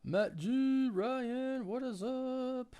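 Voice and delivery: high, loud and drawn out; sing-song stream greeting; excited greeting. Real, not acted. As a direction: call out the names with delight. Greeting chat loudly.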